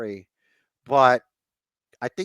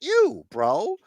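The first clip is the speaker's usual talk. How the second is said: high-pitched, stammering mock disbelief; mock incredulous; 11 semitones higher, much slower than usual. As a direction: retell it as a baffled, indignant comeback. Retelling Sami Zayn's indignant reply.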